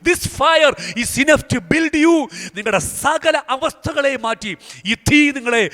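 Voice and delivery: preacher prophesying over the listeners, fervent and high; prophetic preaching. Acted. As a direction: proclaim the promise with rising fervour.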